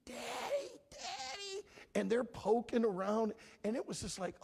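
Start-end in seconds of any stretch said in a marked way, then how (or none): 0.1-1.7 put-on high thin cries of a toddler calling daddy; child screaming for daddy. Father imitates his baby screaming for him on the hospital board. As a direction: call out like a terrified baby, pitched way up. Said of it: "He is screaming".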